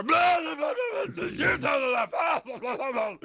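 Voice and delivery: high, loud gibberish hollering; mock screaming. Ruffians walking out of the theater, mock screaming gibberish. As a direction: holler nonsense like rowdy ruffians. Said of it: "as they walk out, they go".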